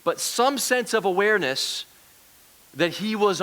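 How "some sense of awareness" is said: "some" pitched up and emphasized; higher, deliberate emphasis on "some"; stress on some. Real, not acted. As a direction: punch the word "some". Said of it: "I say some sense for an important reason".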